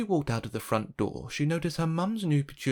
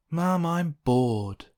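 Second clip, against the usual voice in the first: higher, drawn-out bored complaint; bored child; 5 semitones higher, 4 dB louder, much slower than usual. Acted. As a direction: complain slowly to Mum like a bored kid. Bored child whining to mum.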